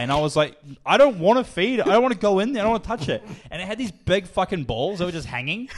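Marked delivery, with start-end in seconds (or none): 0.9-3.2 put-on high, loud kid's refusal; retold protest. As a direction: act out a whiny, insistent tantrum. Child refuses dad's chore.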